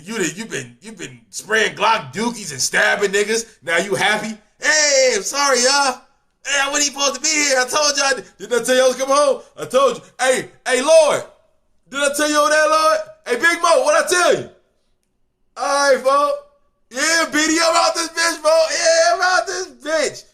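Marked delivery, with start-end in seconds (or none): 4.6-14.5 high, loud celebratory call-outs; jubilant hollering. Retold or imitated. Freed inmate hollering joyfully at guards and family. 15.6-20.2 high, loud triumphant yell; victory shout. Retold or imitated. Freed man shouting goodbye on his way out. Celebrating getting out.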